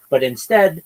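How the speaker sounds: ordinary talk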